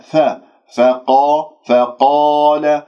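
فقال with a thin ف and an open a; slow, careful فقال with no rounding; light fa. Shown as a demonstration. Teacher drills the letter for the class. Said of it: "فأنت تنطق الفاء".

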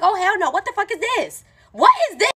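high, hammed-up disbelief; incredulous outrage. Emily kicking friends out of her house, incredulous outrage.

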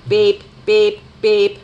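loud, level machine beeps; robot beeping. A narrator's reading. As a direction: beep like a robot on one note. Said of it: "beeped Robot".

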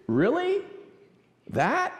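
high, rising disbelief; incredulous questioning. Acted. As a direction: question it sceptically, pitch leaping up, with a pause between. Acting out doubt at the news.

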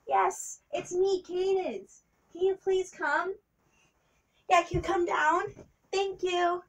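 soft, high hushed voice; secretive phone call. Pretend. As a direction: keep the call furtive and light. Wife makes secret phone call.

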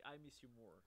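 usual speaking voice

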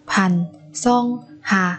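반성하다 with a pause after each syllable. Shown as a demonstration. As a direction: separate every syllable of 반성하다 for learners. Teacher models word syllable by syllable.

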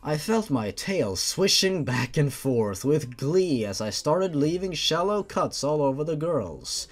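ordinary delivery

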